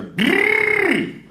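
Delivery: high snarling growl. Retold or imitated. Stranger on the subway behind him, high snarling growl. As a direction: growl it like a wild animal. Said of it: "I hear".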